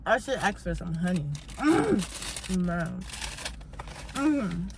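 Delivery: talking through a mouthful of biscuit; mouth full. Real, not acted. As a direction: speak round a bite of food. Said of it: "can't even talk with that biscuit in my mouth".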